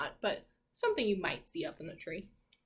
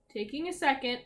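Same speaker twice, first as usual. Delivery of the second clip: plain reading voice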